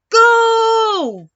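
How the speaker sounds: loud, high starting call; coach's start shout. Acted. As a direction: yell it like a race starter.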